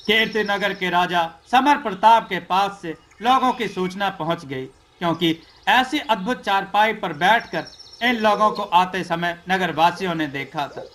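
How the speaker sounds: plain reading voice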